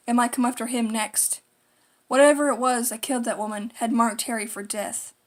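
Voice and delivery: ordinary delivery, nothing marked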